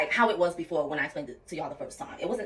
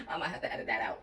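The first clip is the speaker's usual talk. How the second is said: laughing sheepishly; faster than usual. Real, not acted. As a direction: breathe a laugh as you say it, embarrassed. Laughing at her own confession.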